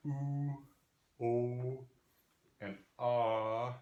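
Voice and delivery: "oo", "o", "ah", each held long; back vowel demo. Shown as a demonstration. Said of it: "in the back we have".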